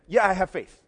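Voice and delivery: put-on the boy's father blurting to Jesus; loud eager claim. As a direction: declare it boldly. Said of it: "This father starts there".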